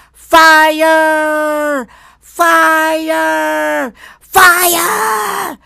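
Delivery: yelling fire. Shown as a demonstration. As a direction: yell at the top of your lungs. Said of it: "Yell at the top of your lungs".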